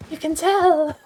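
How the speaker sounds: put-on a playful, not very good British accent; mock-British delight, said loud. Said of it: "I know it's not a very good accent".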